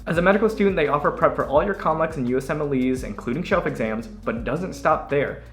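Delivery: upbeat sponsor read voice. Acted. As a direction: sell it brightly like an ad.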